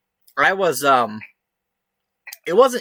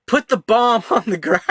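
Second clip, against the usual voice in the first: mock cop shout, pitched higher, breaking into a laugh; retold cop yell; 7 semitones higher than usual. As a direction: yell the order like a panicked cop, half laughing. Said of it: "he screams at me".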